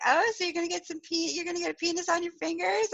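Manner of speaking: high-pitched teasing nail girls, imitated; giggly. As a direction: coo it high like amused onlookers. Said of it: "giggly and they're like".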